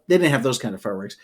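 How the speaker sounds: usual speaking voice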